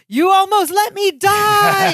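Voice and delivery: high, slow, strained voice; Larry's line. Retold or imitated. Larry answers his cousin after choking, high and strained. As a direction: squeeze it out high and slow like a barely saved man. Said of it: "And Larry says".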